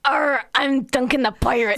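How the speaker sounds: laughing through a mock pirate voice. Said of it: "you gotta sound like a pirate".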